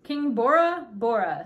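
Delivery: loud, slow, stately delivery; grand self-announcement. A narrator's reading. Little man introducing himself to Ellie, grandly. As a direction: proclaim it slowly with royal importance. Tiny king announces his name.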